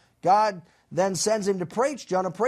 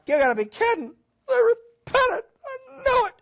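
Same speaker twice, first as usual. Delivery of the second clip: a sulking prophet wailing in disbelief; whiny Jonah; 5 dB louder than usual. Retold or imitated. Jonah complaining to God that Nineveh repented, crying whine. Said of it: "Jonah is like".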